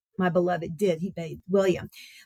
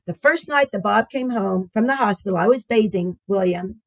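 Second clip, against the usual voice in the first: usual speaking voice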